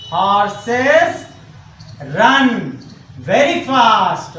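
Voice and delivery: stretched words with pauses between; unhurried, word-by-word teacher delivery; slow dictation. Shown as a demonstration. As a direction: dictate slowly for someone writing it down. Dictating corrected sentence while writing.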